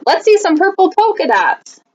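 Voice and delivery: put-on high, excited kid's call; a schoolkid yelling out at Camilla, high and eager. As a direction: shout it across the classroom. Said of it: "One yelled out".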